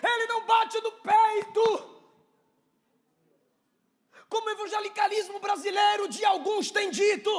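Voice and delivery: high, shouted sermon at full force; fiery preaching. Real, not acted. Preacher humbling the church with Paul's confession, impassioned shouting. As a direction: cry it out to the congregation in long, ringing phrases.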